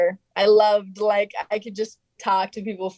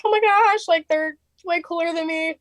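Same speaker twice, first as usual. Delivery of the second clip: starstruck fan; 10 semitones higher than usual. Retold or imitated. Fans looking at stars.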